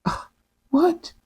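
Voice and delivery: shocked. Retold or imitated. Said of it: "So Esau was like".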